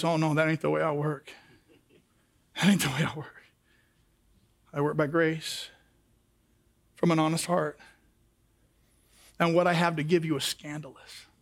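low, soft and calm, unhurried; voice of God. Retold or imitated. God answering the religious seeker, low and gentle. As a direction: speak as God, gently correcting.

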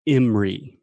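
"Emory" run together in two syllables, EM-ree; pronunciation model. Shown as a demonstration. Answering how to say dad's college, pronunciation model. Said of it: "how do I say".

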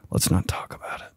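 a quick embarrassed whisper. Acted. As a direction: hush it like a secret. Embarrassed aside after stumble.

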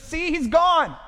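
the enemy gloating that the light is gone; taunting enemy. Retold or imitated. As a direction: sneer it like the tempter. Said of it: "the enemy ... says".

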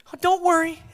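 high, breezy mock reassurance; Mary reassuring parents. Mary breezily telling her parents not to worry. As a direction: play it light and high, shrugging it off. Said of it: "She would have said".

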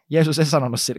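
ordinary talk

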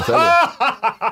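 high laughing voice, drawn out slowly. Real, not acted. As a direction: laugh the words out, high and slow. Laughing reply to banter.

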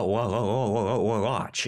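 ordinary talk

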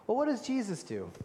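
usual speaking voice

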